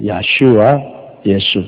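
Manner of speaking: 야슈아 then 예수, low and evenly matched; careful comparison. Shown as a demonstration. Preacher proving the names alike, low careful pronunciation. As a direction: set 야슈아 beside 예수, both low. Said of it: "뭐 똑같다고".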